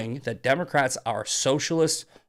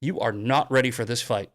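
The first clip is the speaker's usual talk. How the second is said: normal talking voice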